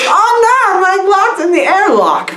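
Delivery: very high monster wail; hammed-up, high-pitched dismay; mock panic. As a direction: squeal it as the trapped monster. Monster trapped in airlock, acted.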